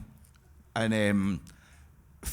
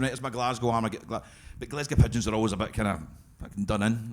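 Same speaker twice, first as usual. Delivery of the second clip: normal talking voice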